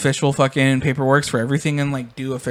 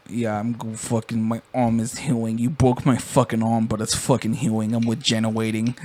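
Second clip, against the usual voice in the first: put-on lower, softer mumbling kid; 5 semitones lower, 5 dB quieter than usual. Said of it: "And he's like".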